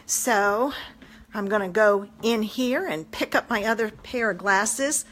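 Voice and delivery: ordinary talk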